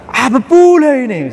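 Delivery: loud, high, slow imitation Batak; Batak accent. Preacher showing students a harsh-sounding friend, loud Batak accent. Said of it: "logatnya itu Batak misalkan".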